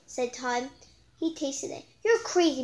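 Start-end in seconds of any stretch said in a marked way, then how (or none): 2.0-2.6 excited exclamation. Acted. Todd voiced after tasting.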